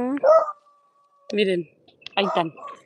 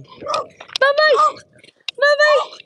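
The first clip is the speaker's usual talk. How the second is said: much higher whispered bye-bye; much slower than usual. Real, not acted. Saying goodbye to the puppy, tiny whispered baby voice.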